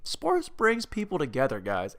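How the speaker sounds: ordinary talk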